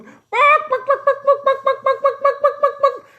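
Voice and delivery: very high, rapid chicken squawks; clucking chickens. Acted. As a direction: imitate a noisy flock clucking in a burst. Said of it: "The chickens are in there".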